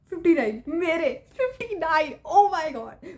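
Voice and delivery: thrilled, savouring the mark; excited squeal. Retold or imitated. Celebrating her maths mark.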